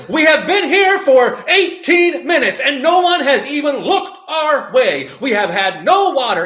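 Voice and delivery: high, emphatic word-by-word cadence; imitated upset complaint. Upset lady kept waiting eighteen minutes, imitated loud complaint. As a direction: imitate a loud, indignant customer. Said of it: "exactly the cadence and how she said it".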